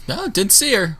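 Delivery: high, breezy brush-off; dismissive guard. Acted. Guard brushing off Johnson's question, high and breezy. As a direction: shrug it off lightly. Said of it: "She just shrugs and goes".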